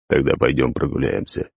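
low, lifeless rasping voice; dead inhuman voice. Acted. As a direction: speak like something dead. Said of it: "мёртвого и нечеловеческого голоса".

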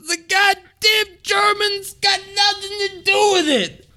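high, shouted angry sheriff imitation; pissed sheriff. Angry sheriff in his car, high mock shout. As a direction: yell it furious, driving down the road. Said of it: "He's so pissed".

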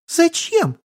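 put-on very high childish question; puzzled little girl. Child asking why she would do it, puzzled squeak.

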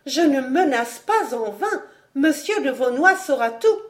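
high-pitched, heated threat; young lady beside herself; provoked beyond endurance. A narrator's reading. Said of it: "cette longue provocation jetait hors d'elle-même".

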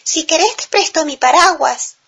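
high little chick voice. A narrator's reading. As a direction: squeak it like a tiny chick. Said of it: "dijo el pollito".

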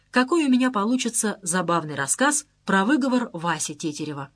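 ordinary delivery

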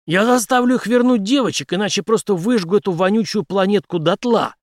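high, loud, forceful threat; hard-driven vow of destruction; menacing. A narrator's reading. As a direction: threaten so fiercely the listener recoils. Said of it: "ответил Генри таким тоном".

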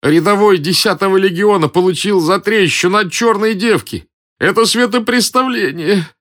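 high, wailing soldier's complaint; hammed-up moan of a beaten legionary; groaning outrage. A narrator's reading. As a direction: lament it at a high pitch, outraged. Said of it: "простонал солдат".

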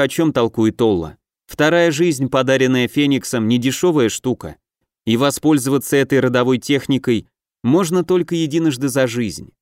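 ordinary delivery, nothing marked

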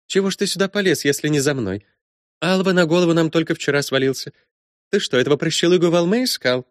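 high, brisk, bossy woman; brash princess voice. Acted. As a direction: play her as a bold, teasing princess. Matilda voiced by narrator.

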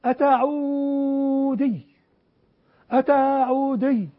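أتعودي stretched very slow, each syllable drawn out; أتعودي said slowly and mockingly; drawn-out pun. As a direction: drag out أتعودي like a mocking nickname. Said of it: "قالوا في السعودية".

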